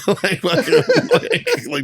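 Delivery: ordinary delivery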